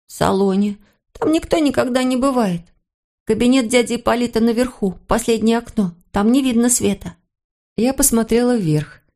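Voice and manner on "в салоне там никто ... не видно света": lightly voiced young boy, higher pitched; a hint of a boy's higher voice. Boy telling governess about the house at night, young boy.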